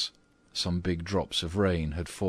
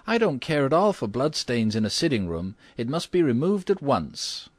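high, emphatic lady character voice; acted outraged mistress of the house; indignant cry; 7 semitones higher, 4 dB louder than usual. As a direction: demand it imperiously, pitched high. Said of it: "cried Missus Otis".

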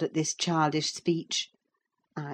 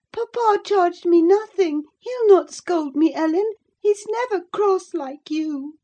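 put-on girlish protest; 5 dB louder than usual. A narrator's reading. Cathy protesting, checked sob.

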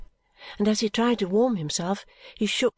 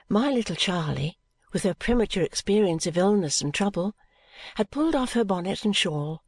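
plain reading voice

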